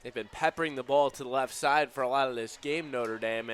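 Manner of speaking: ordinary delivery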